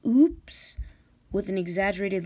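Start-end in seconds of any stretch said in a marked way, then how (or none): 0.1-0.8 high, loud fake surprise; a hammed-up slip of the claw; gleeful fake gasp; mock oops. Pretends to drop the egg.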